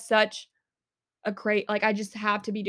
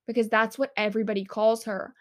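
ordinary delivery

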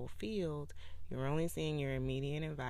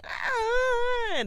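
imitating a TikTok man's high, loud crying wail; mock crying wail; 11 dB louder than usual. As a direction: wail it like a grown man throwing a fit. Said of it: "that's how you sound".

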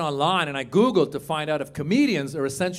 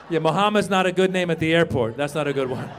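ordinary talk

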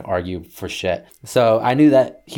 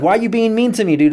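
high-pitched acted complaint; high, raised mock grievance; aggrieved protest; 12 semitones higher, 5 dB louder than usual. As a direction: plead indignantly in a retold quarrel. Acted phone quarrel with dad.

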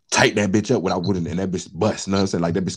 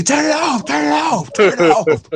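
high frantic yelling; panicked shout; 5 dB louder than usual. Retold or imitated. Plumber yelling at the speaker to shut off the water, panicked shout.

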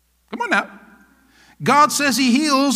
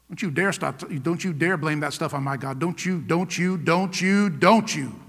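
low, quiet warning; contained anger, repeated as a soft, low threat; righteous anger; 5 semitones lower, 6 dB quieter than usual. Acted. Preacher modelling how Christians confront those blaming God, righteous anger. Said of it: "they should see some anger coming out of you".

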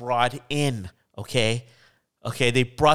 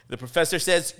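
ordinary talk, nothing marked

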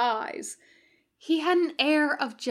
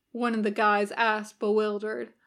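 ordinary delivery, nothing marked

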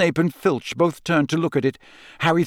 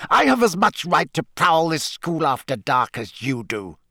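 angry, hissed; 3 semitones higher than usual. A narrator's reading. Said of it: "as though angry with himself".

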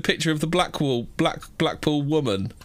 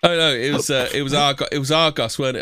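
ordinary talk

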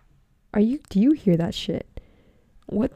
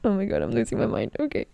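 flustered; faster than usual. Real, not acted. Flustered sign-off.

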